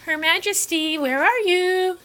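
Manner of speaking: slow high sing-song call. Real, not acted. Calling for the cat.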